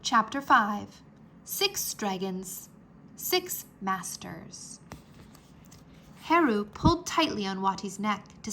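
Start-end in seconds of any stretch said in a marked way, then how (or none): none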